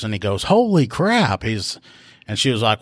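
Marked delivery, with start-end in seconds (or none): 0.4-1.4 drawn-out, high-pitched mock amazement; slow exclamation, pitch jumping way up; astonished. Rookie reacting to the caller's bill, imitated astonishment.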